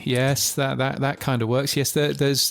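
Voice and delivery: ordinary delivery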